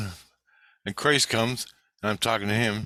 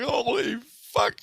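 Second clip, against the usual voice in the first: high-pitched mock shock; high, hammed-up alarm; shocked outcry. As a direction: act out a startled housemate's curse, pitched way up. Housemate's shocked reaction, acted.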